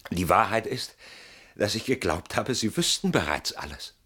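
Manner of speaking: ordinary talk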